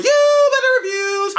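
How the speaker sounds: high, slow, drawn-out announcer squeal; squeaky chipmunk-style title call; high title sting. Pretend.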